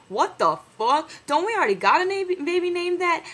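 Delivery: baffled. Retold or imitated. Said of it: "I was like".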